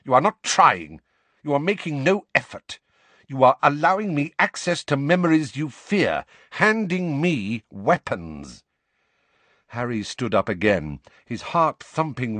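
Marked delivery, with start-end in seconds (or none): 0.0-8.6 loud angry scolding. Acted. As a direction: berate him, louder. Snape berates Harry.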